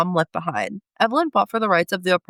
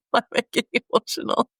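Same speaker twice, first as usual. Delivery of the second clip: quick teary laugh; faster than usual. Real, not acted. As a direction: brush it off with a rushed, choked-up laugh.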